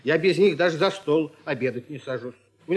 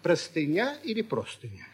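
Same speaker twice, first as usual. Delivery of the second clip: Простыня first stressed on NYA, then простыня on PRO; contrasting stresses; 4 dB quieter than usual. Shown as a demonstration. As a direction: offer pra-sty-NYA or PRO-sty-nya for Простыня или простыня. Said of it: "Как правильно говорить".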